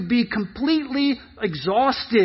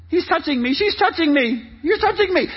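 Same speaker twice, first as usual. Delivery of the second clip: put-on high tattling child, climbing higher; complaining kid; 6 semitones higher than usual. Kids bickering in back of the car, high complaining. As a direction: tattle on a sibling. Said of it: "if you're a kid".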